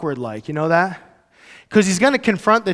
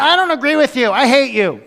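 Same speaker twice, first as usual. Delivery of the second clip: higher, sharper mock quarrel; mock squabble; 6 semitones higher than usual. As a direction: play a petty bickerer.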